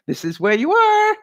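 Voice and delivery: high, hammed-up sing-song announcement; voicing the wavy man. The big wavy man showing her car, high and hammy. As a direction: pitch it high like a silly inflatable.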